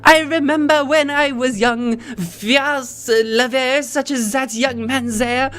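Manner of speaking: high French voice, slowing into wistful reminiscence; French accent. Acted. [name] as French host recalls being young, French accent. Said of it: "[name] does a better French accent".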